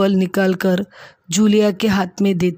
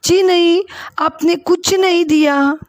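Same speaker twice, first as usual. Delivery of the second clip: a touch of tearful, higher protest; 9 semitones higher than usual. Acted. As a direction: plead through lightly voiced tears. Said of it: "बहुत रोने लगी और कहने लगी". (हल्की रुआँसी, ऊँची आवाज़ में इनकार)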